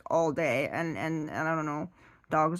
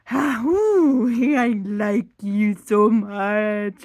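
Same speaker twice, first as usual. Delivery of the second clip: high, loud, very slow contented dog; hammed-up dog in bliss; blissful dog voice; 3 semitones higher, 9 dB louder, much slower than usual. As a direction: moan it like a happy leaning dog. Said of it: "They're like".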